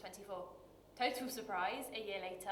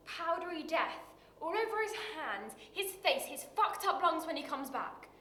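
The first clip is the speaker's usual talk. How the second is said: raised voice, pitched up with indignation; bitter, impassioned; 3 semitones higher, 5 dB louder than usual. Acted. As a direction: drive each phrase out with rising anguish. Bitter account of father's humiliation.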